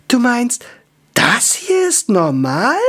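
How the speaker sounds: high, slow incredulous elf; high disbelieving elf girl. Acted. Elf voiced high in story.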